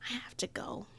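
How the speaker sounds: unvoiced sullen whisper. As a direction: whisper it, upset. Upset teen leaving.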